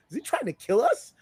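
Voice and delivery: high, loud incredulous quote; mock alarmed question. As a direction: act out a friend's appalled disbelief. Friend's alarm in retold scene.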